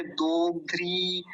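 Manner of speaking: दोग्ध्री with the final ī held; long drawn ī. Shown as a demonstration. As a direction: stretch the end of दोग्ध्री. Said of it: "लंबा खींचेंगे". (दोग्ध्री की बड़ी ई लंबी खींचकर)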